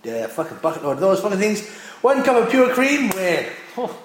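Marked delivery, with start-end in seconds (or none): none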